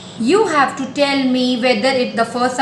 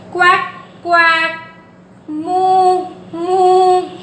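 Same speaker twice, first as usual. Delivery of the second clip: higher, sing-song quacks and moos; playful animal imitations; duck and cow sounds; 5 semitones higher, 4 dB louder, much slower than usual. Shown as a demonstration. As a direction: chant duck then cow noises.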